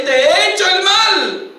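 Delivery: agudo, casi cantado, con dolor; lamento del rey. Acted. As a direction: confiesa la culpa entre lágrimas fingidas. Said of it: "y empieza a llorar a gritos". (rey Saúl confiesa su culpa a David, lamento)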